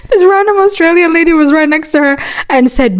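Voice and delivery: high-pitched, laughing through the words; laughing while talking. Real, not acted. Storyteller recounting a stranger stepping in, laughing. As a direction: push the sentence out through real laughter.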